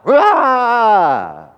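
loud mock roar of a mother going off; imitating mom's roar. Mother going off at her kids, loud mock roar. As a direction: bellow it like a mom blowing her top. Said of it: "My mom used to do that all the time".

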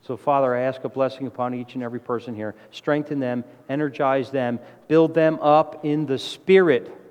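earnest, measured prayer voice. Acted. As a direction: pray over the congregation. Leads closing prayer.

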